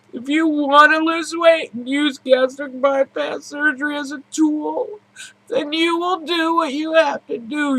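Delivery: high, strained tearful pleading; holding back tears while urging. Real, not acted. Emotional vlog, tearful plea.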